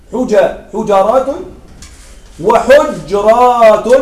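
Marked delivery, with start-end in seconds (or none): 2.4-3.9 وحجرات said wa-ḥujrāt slowly, no vowel after the j; plural with sukun. Shown as a demonstration. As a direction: draw out وحجرات as wa-huj-rāt, the j closed. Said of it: "هذا مع التسكين". (وحجرات بتسكين الجيم ببطء: وحُجْرات)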